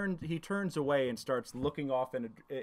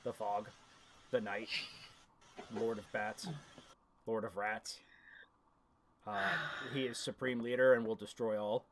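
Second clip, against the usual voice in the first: low, hushed goblin voice; ominous recital of a feared master's names; dread-filled goblin; 8 semitones lower, 3 dB quieter than usual. Acted. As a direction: intone each title low, with pauses between.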